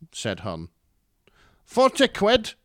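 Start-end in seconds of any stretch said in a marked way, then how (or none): 1.6-2.5 much higher and louder, yapping terrier voice; grating Scottish mum. Acted. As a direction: yap it like a cranky little terrier. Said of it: "Her voice was grating".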